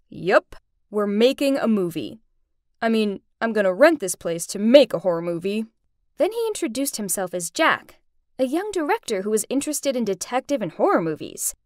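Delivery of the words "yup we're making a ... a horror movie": ordinary delivery, nothing marked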